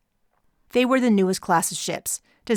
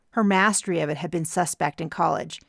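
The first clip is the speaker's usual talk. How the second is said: ordinary delivery, nothing marked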